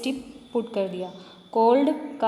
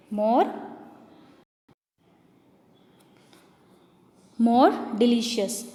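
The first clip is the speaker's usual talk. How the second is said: slow spelled-out phrase; much slower than usual. Shown as a demonstration. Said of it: "यहाँ पर क्या है?".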